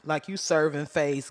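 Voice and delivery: usual speaking voice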